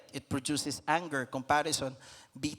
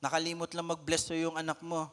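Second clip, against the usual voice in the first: ordinary delivery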